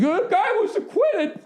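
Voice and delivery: squeaky, slow, laughing mock whine; a high, giggling excuse from a fan caught off guard; defensive whine. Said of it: "I immediately be like".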